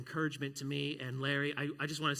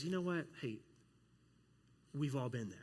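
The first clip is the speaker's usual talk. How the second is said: gentle reassurance; 4 dB quieter than usual. Retold or imitated. Kingdom discernment gently reassuring someone who erred. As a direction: comfort someone who made a mistake.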